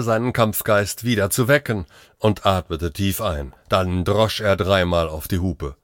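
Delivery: ordinary delivery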